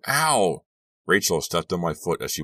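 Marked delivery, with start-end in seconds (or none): 0.1-0.6 high, loud ow; cry of pain. A narrator's reading. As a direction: yelp as your foot is trodden on. Foot stepped on mid-sentence.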